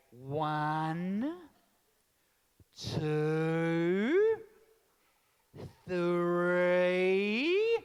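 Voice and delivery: drawn-out patient counting; slow Aussie mum count. Retold or imitated. Aussie mum slowly counting to her naughty child. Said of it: "They go".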